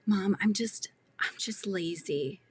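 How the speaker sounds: imitating the daughter in a breathy whisper. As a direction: whisper it like a kid dodging blame. Daughter quoted making an excuse.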